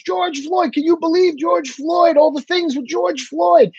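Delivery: a mock news anchor gushing; mocking media hype. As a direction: ham up the breathless outrage. Mocking media hype about Floyd.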